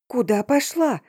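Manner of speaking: mild yelp of a worried friend; yelling. A narrator's reading. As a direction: cry out in protest down the phone. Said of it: "завопила трубка".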